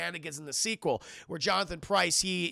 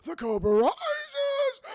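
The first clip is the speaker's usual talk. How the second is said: slow, high, loud theatrical villain proclamation; drawn-out shouted villain voice; hammy villain; 9 semitones higher, 3 dB louder, slower than usual. Retold or imitated. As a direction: proclaim it like a cartoon overlord. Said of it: "Cobra Commander's like".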